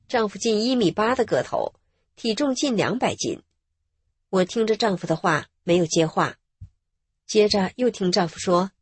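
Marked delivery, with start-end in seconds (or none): none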